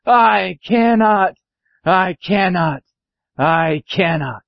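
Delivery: slow refrain, sinking each time; slow, drawn-out weary refusal; weary old engine. Acted. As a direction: drag each word, winding down. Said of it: "off he rumbled to the roundhouse".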